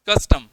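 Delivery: Custom with a loud कस and a weaker टम; stress on first syllable. Shown as a demonstration. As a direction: hit कस in custom, let टम sink. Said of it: "उसमें टम की जो आवाज़ है ... थोड़ी दब जाती है".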